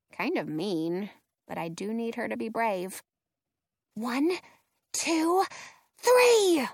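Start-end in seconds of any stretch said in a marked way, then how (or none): 3.9-6.7 whisper yell. A narrator's reading. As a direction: count up, burst on three. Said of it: "I whisper yell".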